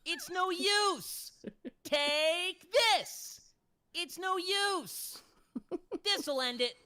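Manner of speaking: high, slow, loud battle yells; Silver classic lines. Pretend. Voice actor repeating Silver's classic lines, high and loud. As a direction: shout each line like a video game hero. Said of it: "Those classic lines".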